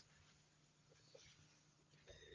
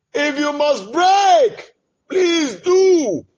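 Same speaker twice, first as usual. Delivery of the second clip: pleading cry; 6 semitones higher, 4 dB louder, slower than usual. Real, not acted. Pleading with the Father.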